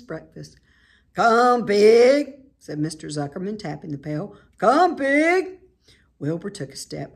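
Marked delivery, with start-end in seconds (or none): 1.1-2.4 loud farmer's call; loud coaxing call. A narrator's reading. Farmer calling pig. 4.6-5.6 loud farmer's call; loud coaxing call. A narrator's reading. Farmer calling pig.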